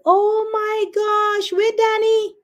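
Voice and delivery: high-pitched, drawn-out dismay; shocked realisation. Real, not acted. Host realises she forgot Danny's link, high shocked wail. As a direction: cry out as the blunder dawns on you.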